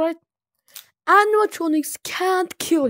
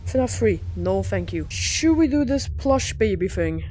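ordinary talk

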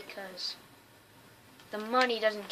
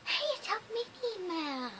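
playful high exclamation; 9 semitones higher, 6 dB quieter than usual. Pretend. Playful greeting of a mini map.

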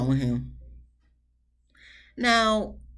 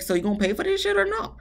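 mock confrontation; 14 semitones higher, faster than usual. Imagined confronting of her friend.

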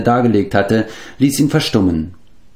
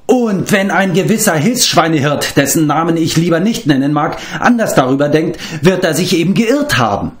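put-on higher, sarcastic girl; mocking jab; 9 semitones higher than usual. A narrator's reading. Eilonvi mocks Taran, sarcastic and higher. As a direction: needle the assistant pig-keeper with scorn.

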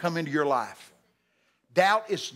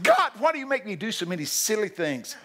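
higher-pitched whining complaint; 6 semitones higher than usual. Retold or imitated. Preacher retelling his grumble to God, whining. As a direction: whine it as a complaint. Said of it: "One time I was whining".

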